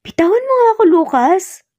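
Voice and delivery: high, startled cry of protest; flustered wife pleading with Lucas to let go. Acted. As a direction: push him off, half panicked, half embarrassed. Emily pushes Lucas away.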